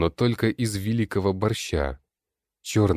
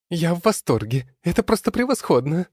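very high, loud delighted shriek; gleeful squeal; 12 semitones higher, 4 dB louder than usual. Acted. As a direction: shriek with glee. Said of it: "Не унимается лень".

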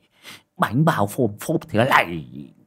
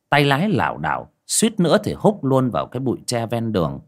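ordinary delivery, nothing marked

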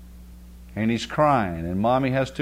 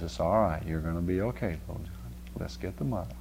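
soft, tender comfort, much quieter; mother comforting; 4 semitones lower, 6 dB quieter than usual. Retold or imitated. Mother soothes her muddy crying boy, soft and tender. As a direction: hush it gently to a crying child. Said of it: "and says".

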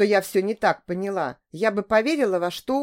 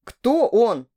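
louder, sharply indignant; 3 semitones higher, 6 dB louder than usual. A narrator's reading. Man accused by his lover, indignant. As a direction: demand it, offended. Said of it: "возмущённо посмотрел на меня Борис".

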